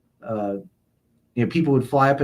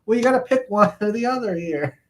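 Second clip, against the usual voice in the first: mock exasperated quote; 9 semitones higher than usual. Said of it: "I'm like".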